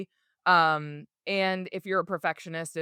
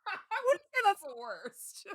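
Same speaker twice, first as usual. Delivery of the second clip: laughing; 12 semitones higher, 5 dB quieter than usual. Real, not acted. Laughing at cat joke.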